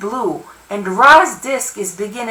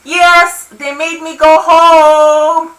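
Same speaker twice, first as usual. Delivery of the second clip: loud, high, drawn-out shout; yelling back; 6 semitones higher, 6 dB louder, much slower than usual. A narrator's reading. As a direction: holler it back up to a neighbor. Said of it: "I yell back".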